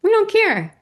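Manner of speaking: exuberant, sing-out climax of a retold moment; gleeful shared declaration. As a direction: shout it high as the triumphant last refrain. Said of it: "we started laughing, like".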